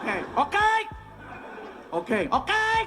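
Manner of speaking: high-pitched, rising okays; awkward okay exchange. Retold or imitated. Standoff with waiter hovering over coffee.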